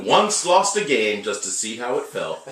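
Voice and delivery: loud announcer build-up. Acted. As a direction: introduce him like a ring announcer. Announcing cohost.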